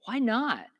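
ordinary talk, nothing marked

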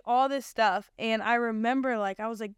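ordinary delivery

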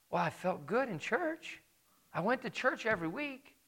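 a hint of a pleading churchgoer making excuses; mock excuse. Quoting excuses of the condemned.

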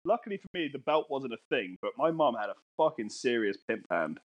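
usual speaking voice

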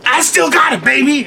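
strut it, full of yourself; proud improviser showing off to the crew; cocky boast. Retold or imitated. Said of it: "And I was like".

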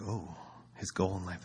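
quiet mock anticipation; amused inner aside. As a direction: say it under your breath, amused.

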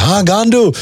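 high, sing-song teasing "Ah, gandu"; mate greeting the host with a friendly insult; mock Indian greeting.

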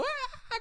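high-pitched mock bawl; imitated crying wail. Children fleeing down the street crying, mock wail. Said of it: "Nosotros todos llorando".

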